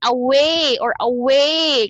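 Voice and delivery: "away" with a long, wide "way"; exaggerated pronunciation. Shown as a demonstration. Teacher shows students the mouth movement, exaggerated pronunciation. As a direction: draw out the "way" in "away". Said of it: "You just pronounce it".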